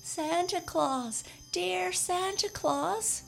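high, slow, wailing plea; sobbing cry. A narrator's reading. Scarecrow sobbing as he calls out to Santa. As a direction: cry out to Santa through a great sob. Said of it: "cried Jimmy Scarecrow with a great sob".

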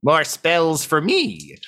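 high, slow gleeful voice; character voice. Acted. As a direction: savor it slowly, pleased. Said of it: "And he says".

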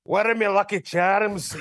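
cartoonish Irish accent; mock Irish brogue; Conor McGregor impression. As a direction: do a hammy stage-Irish leprechaun voice. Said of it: "is this Connor?".

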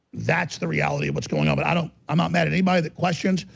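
ordinary talk, nothing marked

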